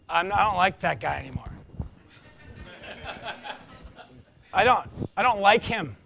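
grumbling aside. Retold or imitated. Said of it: "in his mind just go".

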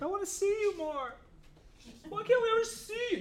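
high, whiny parent voice; mock parent. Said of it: "Parents are always like".

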